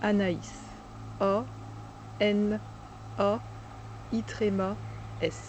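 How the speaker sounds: Anaïs, then each letter named on its own with a pause; spelling letter by letter. Shown as a demonstration. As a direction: spell it out for small children, one letter at a time. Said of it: "C'est important de dire chaque lettre aussi".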